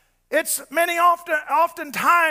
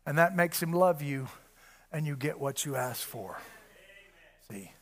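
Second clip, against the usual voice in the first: low, soft, earnest sermon voice; hushed preaching; 8 semitones lower, 6 dB quieter than usual. Real, not acted. Preacher pleading quietly with believers about unbelief, hushed. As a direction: bring it down low and close.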